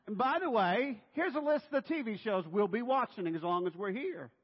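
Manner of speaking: high, loud, breezy extra demand; bossy houseguest. Acted. Guest dictating TV shows to hosts, bossy announcing.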